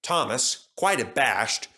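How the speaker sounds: plain reading voice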